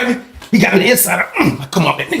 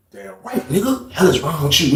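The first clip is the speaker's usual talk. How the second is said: usual speaking voice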